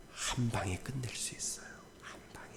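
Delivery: secretive stage whisper. Real, not acted. Pastor whispering a secret to the congregation.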